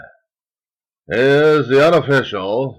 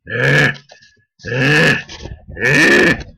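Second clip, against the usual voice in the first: straining grunts; 4 semitones higher, 4 dB louder, much slower than usual. Real, not acted.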